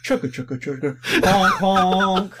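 chugging then honking; train noises. Pretend. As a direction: play a choo-choo train. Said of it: "trying to think of a train".

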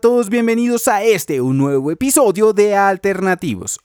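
podcast host intro. Acted.

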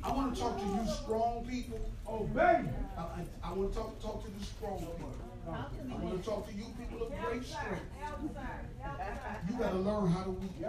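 quiet preaching. Acted. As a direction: bring the church down to a hush about tears. Tender quiet sermon passage.

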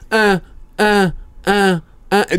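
high-pitched repeated uh sounds, imitating; mock high grunting. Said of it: "thirty minutes of".

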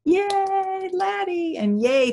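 high, drawn-out "yay"; celebratory high-pitched hooray; cheering. Real, not acted. Speaker cheers the technique.

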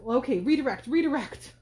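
loud, hurried course correction; a mock-urgent change of subject, said loud; urgent redirect. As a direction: snap the topic back quickly, mock-urgent. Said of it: "So he's like, sort of".

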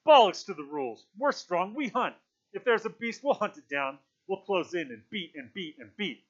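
loud, defiant war cry; shouting. A narrator's reading. Jack shouts against Ralph, loud and defiant. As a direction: shout it down, rallying the hunters. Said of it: "Jack was shouting against him".

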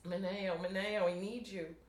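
pleading cry for Mineo; mild acted outcry. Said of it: "she cries repeatedly for Mineo".

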